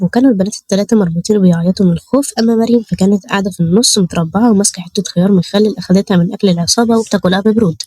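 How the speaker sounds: usual speaking voice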